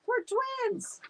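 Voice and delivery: very high squeaky claim; cheeky bluff. Acted. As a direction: sell the lie brightly. Dog bluffing to teacher.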